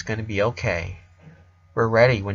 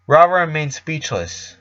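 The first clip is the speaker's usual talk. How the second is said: usual speaking voice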